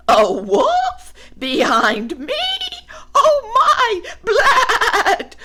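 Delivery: shrill, loud panic; panicked. Acted. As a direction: squeal in fright. Woolly panics.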